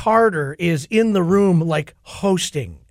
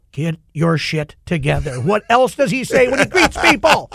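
imitating a wife snapping at him, higher and louder; mock scolding wife; 7 semitones higher, 4 dB louder than usual. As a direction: play the exasperated girlfriend telling him off.

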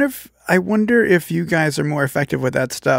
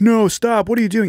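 high, louder call to stop them; mock bystander shout; 9 semitones higher, 3 dB louder than usual. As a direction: yell like the crowd trying to break it up. Bystanders yelling at fighters.

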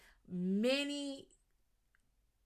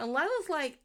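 ordinary delivery

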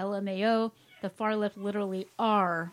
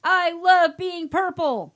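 loud, high, slow cartoon cheer; drawn-out, hammed-up joyful shout; Patrick Star; 9 semitones higher, 14 dB louder, slower than usual. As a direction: bellow it like a gleeful cartoon dimwit. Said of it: "Patrick Starr".

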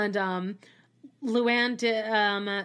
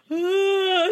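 higher, louder yelp of panic; mock distressed cry; 9 semitones higher, 4 dB louder than usual. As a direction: cry out like a turtle on its back. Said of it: "Ramona's like".

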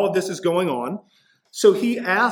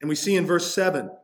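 ordinary talk

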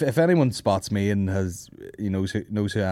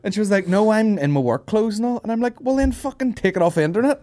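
high, louder exasperated retelling; mocking high voice; 11 semitones higher, 5 dB louder than usual. Comic mocking a fan who wanted a photo. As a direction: play it up as a mocking squeak. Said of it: "retelling fan photo chat".

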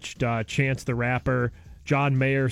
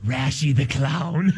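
spooky clown voice. Retold or imitated. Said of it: "when I told you".

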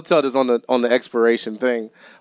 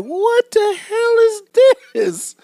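1 shocked; 5 dB louder, much slower than usual. Retold or imitated. Finding moldy chocolate chips.

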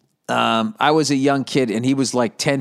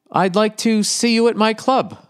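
imitating the club owner; 9 semitones higher than usual. Comic quotes the club owner inviting him, in a higher put-on voice. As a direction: do the booker's voicemail line. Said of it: "That's the way he did it".

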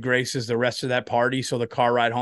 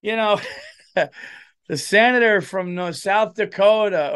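a hint of a pompous introduction; announcer introducing senator; 7 semitones higher, 5 dB louder than usual. Crowd announcer presenting the senator, lightly mocked. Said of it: "they were like".

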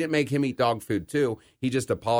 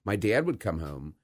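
normal talking voice

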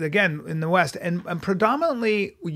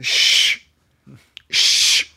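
long, sharp "shh"; librarian shushing; 5 semitones lower, 3 dB louder than usual. Acted. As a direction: hush the room like a librarian. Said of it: "my impression of a librarian".

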